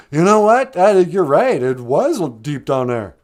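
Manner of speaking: high and loud, still panting from the dive; breathless admission. Acted. The loon admits defeat to the others, breathless and loud. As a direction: gasp the words out between breaths.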